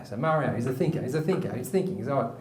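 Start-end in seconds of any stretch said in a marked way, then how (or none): none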